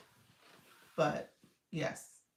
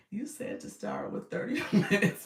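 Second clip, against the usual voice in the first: voice effect; 3 semitones higher, 5 dB louder than usual. Real, not acted. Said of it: "let me change my voice".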